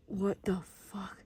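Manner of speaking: low, very soft whisper; disbelieving whisper. Real, not acted. Camper spying on the man nearby, disbelieving whisper. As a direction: whisper it under cover.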